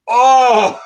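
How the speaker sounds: high loud startled cry; hammed-up pained shout; mock yelp. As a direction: yelp as if punched again. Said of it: "I went".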